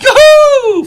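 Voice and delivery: high, loud whoop; mock cheer. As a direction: whoop it up sarcastically. Mock cheer about wild card.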